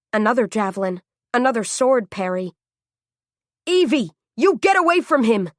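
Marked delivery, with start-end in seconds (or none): 3.7-5.5 loud, high urgent shout; bellowing. A narrator's reading. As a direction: bellow the warning at her. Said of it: "Jack bellowed".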